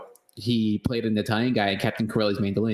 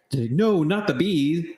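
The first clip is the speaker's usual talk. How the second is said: higher panicked mock wail; mock Nick Cage; 8 semitones higher, much slower than usual. Nick Cage refusing the bee belt, higher hammed-up wail. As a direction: ham up a Nick Cage freakout. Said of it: "and he's like".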